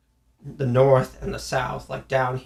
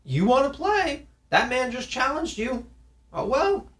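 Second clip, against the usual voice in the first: much higher, teasing parent voice; mock parent egging on kid; 8 semitones higher than usual. Parent to kid told off by coach, high teasing voice. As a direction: playfully goad the child to take the challenge. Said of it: "I'd be like".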